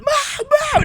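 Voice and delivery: high screaming for mom; panicked screaming. Retold or imitated. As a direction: shriek for mom to stop. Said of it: "they're just".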